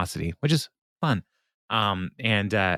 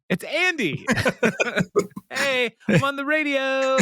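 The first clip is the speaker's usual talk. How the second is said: much higher, cartoonish Andy on the radio; goofy Andy voice. Retelling the Andy caller bit in a high goofy voice. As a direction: play the dopey guy thrilled to hear himself on air.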